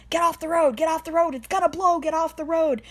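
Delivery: high, loud urgent warning yell. Retold or imitated. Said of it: "I'm yelling".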